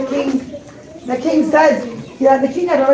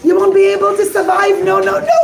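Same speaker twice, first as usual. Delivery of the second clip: alarmed warning; 7 semitones higher than usual. Acted. Captain begs the rabbi not to jump, alarmed. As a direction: hold him back, a touch frightened. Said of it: "And he said".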